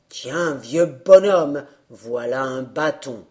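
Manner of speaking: slow, low, weighty voice; wind character. A narrator's reading. The wind giving the old man a stick, slow and low. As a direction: hand it over slowly and grandly.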